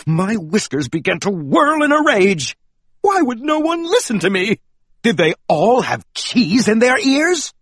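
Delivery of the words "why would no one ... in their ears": ordinary delivery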